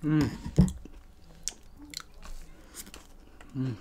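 chewing while humming; murmuring between bites; mouth full of cookie. Real, not acted. Seen 2: bites cookie repeatedly, cheeks full, chewing throughout the "mm" sounds.

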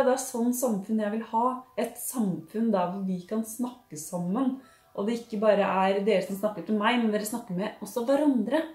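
normal talking voice

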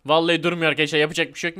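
ordinary delivery

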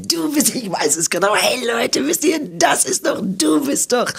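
high, excited stammering fan; mock excited fan, pitched high; eager fan. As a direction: play the fan who can't recall the name. Fan calling to others.

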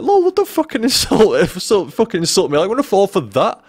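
high squeaky disbelief; incredulous. Retold or imitated. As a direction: scoff at the obvious trick. His incredulous reaction retold.

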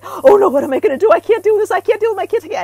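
shrieked high and very loud in panic; panicked client. Retold or imitated. Mimicking a client hitting ten, shrieking in hysterical panic. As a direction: wail it like an overwhelmed mom at breaking point. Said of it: "It's like".

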